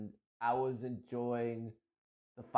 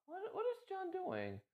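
puzzled onlookers; 11 semitones higher than usual. Retold or imitated. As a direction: mimic confused players watching John. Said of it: "people were like".

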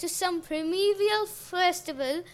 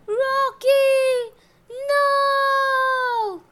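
high, loud, drawn-out theatrical wail; screamed tantrum; 10 semitones higher, 9 dB louder, much slower than usual. A narrator's reading. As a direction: wail it out in an Oscar-worthy tantrum. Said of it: "She screamed and stomped her feet".

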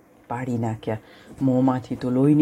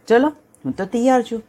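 eager lion; 8 semitones higher, 4 dB louder than usual. Acted.

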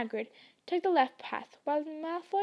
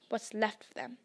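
nastily; 2 semitones lower, 6 dB quieter than usual. A narrator's reading. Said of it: "he added nastily".

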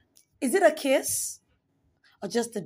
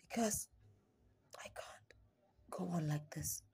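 slow, much softer whisper, almost gone; despairing whisper; 4 semitones lower, 14 dB quieter than usual. Acted. Poet at breaking point to the listener, whispered. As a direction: let the words drain out in a whisper. Poem's lowest, despairing moment.

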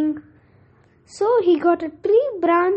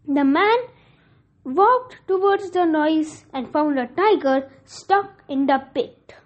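ordinary delivery

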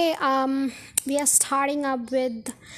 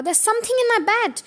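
panicked scream; 7 semitones higher, faster than usual. Acted. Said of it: "screamed Bouncing about".

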